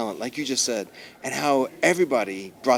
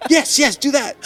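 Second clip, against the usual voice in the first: higher and louder, thrilled agreement; eager yes; 7 dB louder than usual. Retold or imitated. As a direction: cheer her on eagerly. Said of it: "We're like".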